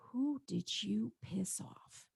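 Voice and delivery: slow, hushed, conspiratorial whisper; mock whisper. Friend warning her about an enemy, slow whisper. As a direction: lean in and whisper it slowly, like a secret. Friend's blunt diagnosis, retold.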